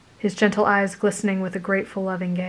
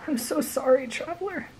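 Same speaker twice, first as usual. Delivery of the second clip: soft broken apology; fading tearful sorry; voice breaking; 7 semitones higher, 7 dB quieter than usual. A narrator's reading. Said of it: "his voice broke".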